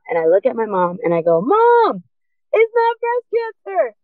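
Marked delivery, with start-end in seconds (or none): 1.4-4.0 high-pitched, elated call to mom; retold thrilled cry. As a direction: draw it out, very high, like great news.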